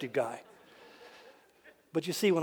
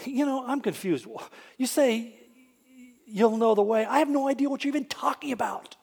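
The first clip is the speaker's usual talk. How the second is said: higher, puzzled voice; confused Thomas; 7 semitones higher, 4 dB louder than usual. Retold or imitated. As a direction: sound lost and baffled.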